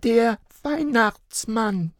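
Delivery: put-on high, slow child voice; steadfast little girl. A narrator's reading. Little girl insisting to her parents, high and slow. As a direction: insist like a stubborn small child. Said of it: "blieb Luca standhaft".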